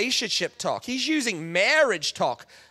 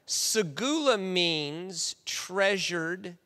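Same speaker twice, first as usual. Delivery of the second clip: ordinary talk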